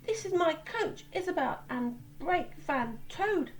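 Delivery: high, light small engine voice. Acted. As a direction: introduce friends brightly, high. Oliver introduces his train.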